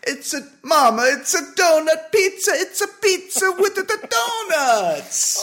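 put-on very high, loud Italian accent; jolly Italian pizza chef. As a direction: squeal with delight at Mama. Continues the Italian bit.

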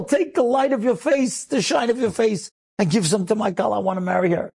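high, impassioned imitation of a crying prayer; reenacted desperate prayer for a bride; retold tearful plea. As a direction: beg God urgently, pitched well up. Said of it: "And he's crying".

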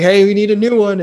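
loud, high call-out; imitated kitchen call. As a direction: holler the reorder as if to the cooks. Calling an order to kitchen.

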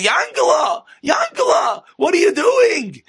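put-on very high old-lady holler; imitating an old wife calling out; shrieky mock scolding from a little old lady. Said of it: "he hears a little old lady".

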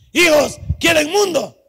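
loud, high, slow shout; calling out a challenge. Acted. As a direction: holler it like a father confronting his children. Voices God challenging his children.

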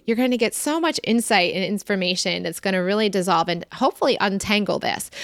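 ordinary delivery

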